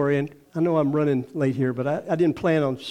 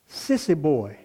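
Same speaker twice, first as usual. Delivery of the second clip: usual speaking voice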